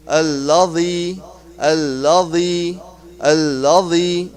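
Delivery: "Allazi" slowly, its z softened to th as in "this"; correct dh sound. Shown as a demonstration. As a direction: draw out "Allazi" as "al-la-dhee". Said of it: "we will be pronouncing it like".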